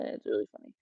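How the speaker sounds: whispered aside, amused; voiceless amused aside. Real, not acted. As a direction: breathe it out as a secret.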